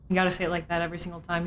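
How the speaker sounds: ordinary talk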